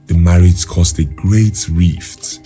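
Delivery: usual speaking voice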